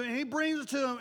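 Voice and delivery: ordinary delivery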